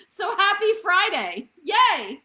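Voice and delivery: loud, high cheer; excited cheer. Real, not acted. Cheering happy Friday.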